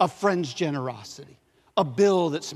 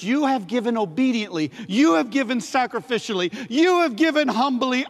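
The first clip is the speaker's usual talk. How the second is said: building praise; 7 semitones higher, 4 dB louder than usual. Acted. Preacher building Paul's praise.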